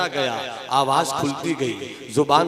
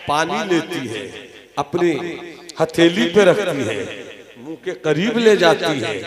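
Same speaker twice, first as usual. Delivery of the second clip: ordinary talk, nothing marked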